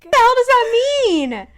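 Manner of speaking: high, loud, drawn-out disbelief; baffled outburst. Real, not acted. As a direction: burst out in loud, slow bafflement. Baffled by an unfamiliar phrase.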